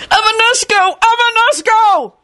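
very high, drawn-out frantic spell cries; wild repeated shout. Acted. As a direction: keep screeching the spell in desperation. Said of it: "Arthur shouted wildly".

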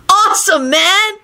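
high-pitched thrilled shriek. A narrator's reading. Kenny thrilled by the dead men, shrieking. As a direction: shriek with excitement. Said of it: "Kenny shrieked".

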